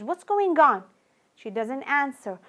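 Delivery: ordinary delivery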